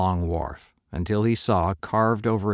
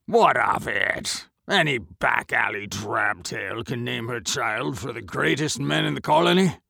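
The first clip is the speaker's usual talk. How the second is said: scoffing merchant; 4 semitones higher than usual. Acted. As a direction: brush off the claim.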